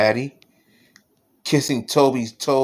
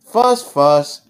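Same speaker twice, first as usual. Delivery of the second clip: louder, emphatic mock fuss; 6 dB louder than usual. A narrator's reading. Daddy being a fussing mouse, loud playful fussing. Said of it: "I am a fussing mouse".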